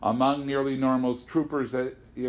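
usual speaking voice

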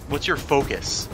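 usual speaking voice

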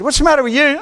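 a touch of mock concern; grandpa asking. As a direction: ask it like a doting grandpa. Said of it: "and I said".

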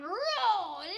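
loud, high roaring rocket sound; engine roar. Acted. Engine noise in launch story.

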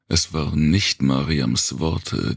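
ordinary delivery, nothing marked

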